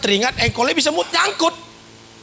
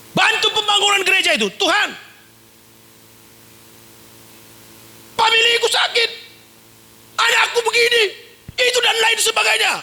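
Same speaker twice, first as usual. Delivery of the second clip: pleading believer; 10 semitones higher than usual. Retold or imitated. Mocking a believer's bargaining prayer.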